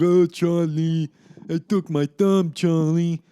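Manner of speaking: slow, loud, high mock wail; Paulie's anguished wail. Imitating the man crying to Charlie about his thumb, wailing. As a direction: draw it out in hammed-up agony. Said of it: "screaming, doing his whole".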